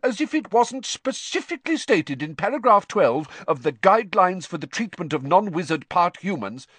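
high, pompous indignant tirade; outraged, fussy complaint without let-up; furious. A narrator's reading. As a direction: rant on as an affronted official. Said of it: "said Percy furiously".

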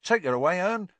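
cockney lad. A narrator's reading. Stan ordering driver.

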